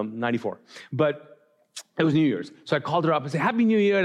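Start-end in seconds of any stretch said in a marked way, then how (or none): 3.4-4.0 higher, cheery retold New Year greeting; cheerful greeting. Pastor retelling his New Year's call to his girlfriend, cheerful greeting. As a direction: call out a happy holiday wish.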